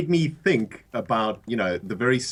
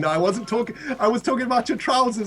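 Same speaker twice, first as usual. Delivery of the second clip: higher, hurried nervous backpedalling; higher pitched, embarrassed self-correction; flustered apology; 8 semitones higher than usual. Retold or imitated. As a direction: scramble to apologise to the posh boss.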